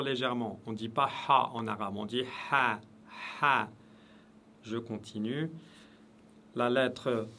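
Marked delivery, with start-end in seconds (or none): none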